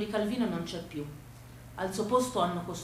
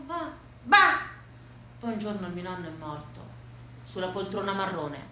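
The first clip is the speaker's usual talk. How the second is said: ordinary delivery, nothing marked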